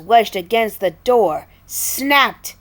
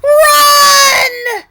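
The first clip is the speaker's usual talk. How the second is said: high piercing shout; yell; 11 dB louder than usual. Acted. Chuck yelling back to Red to flee, piercing shout. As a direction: scream a warning. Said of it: "Chuck yelled back".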